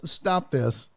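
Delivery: ordinary delivery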